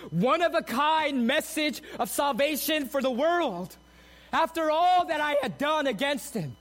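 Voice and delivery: high, loud, fervent preaching build; impassioned preaching. Acted. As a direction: lift the congregation with rising fervor. Sermon rising to full preaching.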